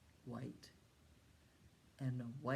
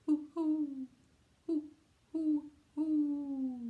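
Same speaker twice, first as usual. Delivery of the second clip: high hooting owl call; 11 semitones higher, 8 dB louder, much slower than usual. Shown as a demonstration. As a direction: hoot like an owl for the kids. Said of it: "What does an owl say?".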